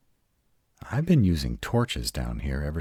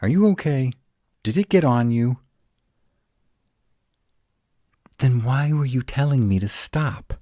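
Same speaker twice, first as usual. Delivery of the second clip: louder and higher, anxious; worried; 9 semitones higher, 6 dB louder than usual. Acted. To startled partner in the tunnel, anxious loud concern. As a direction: rush to check she's hurt.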